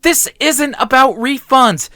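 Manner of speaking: high, loud, exasperated protest; frustrated outburst. A narrator's reading. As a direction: spell it out in frustration. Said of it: "I moaned in frustration".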